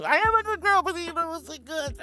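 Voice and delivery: very high, loud squeaky voice; squeaky good girl. Pretend. Streamer squealing back at a sir's praise. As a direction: squeal it like an overexcited pet. Playful puppy act for chat.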